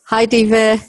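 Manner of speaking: normal talking voice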